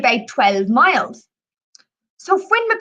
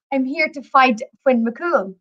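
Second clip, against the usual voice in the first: normal talking voice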